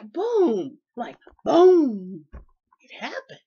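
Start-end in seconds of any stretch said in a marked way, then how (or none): 0.0-2.3 loud emphatic boom. Real, not acted. Telling friends how fast God delivered, a loud boom. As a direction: slam the word down with sudden force. Sudden breakthrough exclaimed.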